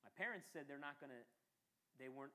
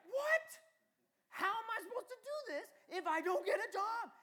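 high, loud disbelieving cry; hammed-up shock at the answer; mock indignant protest; incredulous outburst; 15 dB louder, slower than usual. Arguing back with God.

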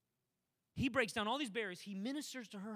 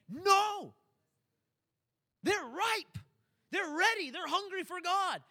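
much higher and louder, slow on the last line; fervent preaching; 9 semitones higher, 8 dB louder, slower than usual. Acted. Preacher voicing Jesus telling disciples the harvest is ready, fervent cry.

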